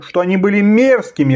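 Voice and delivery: usual speaking voice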